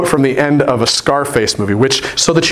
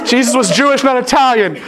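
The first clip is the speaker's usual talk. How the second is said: mock alarm; 11 semitones higher than usual. Pastor retelling his reply to the kids, high mock alarm. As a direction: stop them short in playful alarm. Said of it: "I was like".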